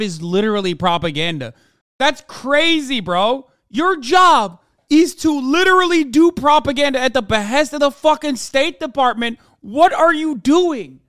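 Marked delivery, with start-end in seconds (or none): 2.0-11.0 louder and higher, slowed in disbelief; furious disbelieving rant; exasperated yell. Real, not acted. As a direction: yell at him, baffled. Ranting at the press spokesman.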